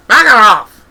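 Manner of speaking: put-on shrill loud old-woman scream. Granny shrieking at the birds while flapping her hat. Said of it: "screamed Granny".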